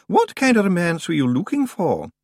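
a lightly voiced prim young librarian; Miss Jenkins. A narrator's reading. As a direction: answer crisply and politely.

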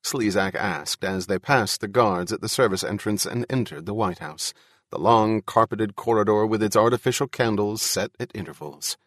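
plain reading voice

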